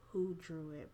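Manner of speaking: usual speaking voice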